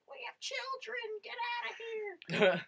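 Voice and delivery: imitated screechy yell in a falsetto pitch; mock neighbor screaming. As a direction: screech it like an outraged neighbor, without full volume. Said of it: "our neighbors would come out screaming".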